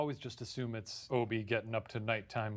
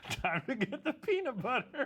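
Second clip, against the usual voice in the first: much higher, gleeful creep; creepy man; 13 semitones higher than usual. Pretend. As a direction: gloat over the treat.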